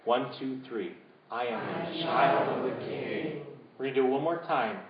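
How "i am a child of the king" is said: slow, deliberate spoken affirmation; steady congregational declaration; a little louder. Shown as a demonstration. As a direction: say it out, slowly. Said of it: "say it a little louder".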